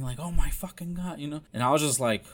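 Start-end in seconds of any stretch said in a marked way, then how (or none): 0.2-1.2 mock mom's cry, raised in pitch; retold distraught yell. Son imitating his crying, yelling mom, mildly raised yell. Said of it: "she's just crying and yelling".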